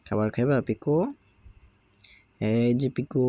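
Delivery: cooing at a sulky parrot chick; coaxing pet talk. Real, not acted. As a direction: sweet-talk the sulking bird onto your hand. Coaxing pet bird Piku.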